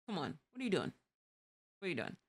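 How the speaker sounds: quiet disbelief at the road; mild exasperated mutter. Shown as a demonstration. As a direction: mutter it to a bad driver under breath. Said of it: "I say it just like that".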